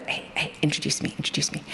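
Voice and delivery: eager engineers whispering; hushed, excited whisper to be introduced; mock whisper. Engineers eager to meet Gene.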